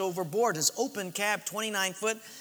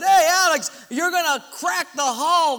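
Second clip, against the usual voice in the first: loud high shout over the storm; 8 semitones higher, 6 dB louder than usual. Retold or imitated. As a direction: call out to Alex over the wind. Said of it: "One of the guys even said".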